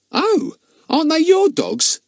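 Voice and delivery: high, wide-eyed innocent surprise; surprised. Acted. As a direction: play innocent astonishment. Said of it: "in such a surprised voice".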